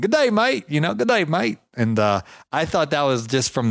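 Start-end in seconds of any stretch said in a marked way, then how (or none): 0.0-0.7 mock Aussie "Good day, mate" pitched high; a hammed-up Australian "Good day, mate"; American imitating an Australian greeting; Australian accent. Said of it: "with your accent". 0.9-1.6 mock Aussie "Good day, mate" pitched high; a hammed-up Australian "Good day, mate"; American imitating an Australian greeting; Australian accent. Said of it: "with your accent".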